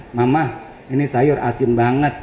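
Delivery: a husband grumbling the soup is too salty; husband complaining. Retold or imitated. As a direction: sound like a picky husband. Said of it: "Kata lakinya begini".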